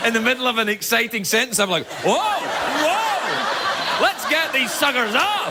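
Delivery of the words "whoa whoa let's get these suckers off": high, slow, hammed-up whoa; a silly mock-excited gasp; mock excitement. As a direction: play up the thrill, drawing it out. Mock excitement over headline.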